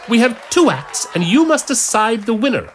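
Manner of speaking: high, loud announcer voice; stage announcer. Acted. Priest announces the contest to the crowd, loud announcer. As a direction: address the whole hall.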